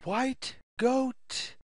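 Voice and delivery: ordinary delivery, nothing marked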